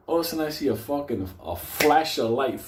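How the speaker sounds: ordinary delivery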